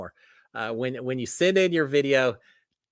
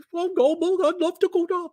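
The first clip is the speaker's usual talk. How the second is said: put-on a brief, much higher sing-song Swedish accent try; a quick failed stab at a Swedish accent; attempted Swedish accent. Joking with viewers about visiting Sweden, attempted Swedish accent. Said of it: "but I can't do a Swedish accent".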